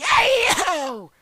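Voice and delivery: high, loud call; brash yelled greeting; shouted hey. Retold or imitated. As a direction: hail your buddy. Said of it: "And I was like".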